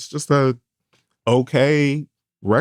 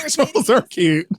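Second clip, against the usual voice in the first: laughing; slower than usual. Real, not acted. Defending trolls, laughing shout.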